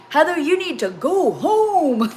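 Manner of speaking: loud, high, drawn-out exclamation; exasperated quote. Retold or imitated. Quoting their reaction to Heather's behaviour, exasperated. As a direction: stretch it out high and loud, half laughing.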